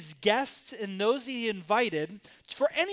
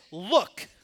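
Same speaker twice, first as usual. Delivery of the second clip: a blunt, louder "Look" with no respect; disrespectful curt address; 2 semitones lower, 4 dB louder than usual. Shown as a demonstration. Son answering his father with a blunt, disrespectful "Look". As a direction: say "Look" as a rude brush-off. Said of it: "an incredible, disrespectful way".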